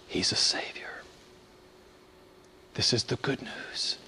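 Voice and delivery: whisper. Real, not acted. Preacher whispering the good news to congregation. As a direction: breathe it out as a secret. Hushed climax of point.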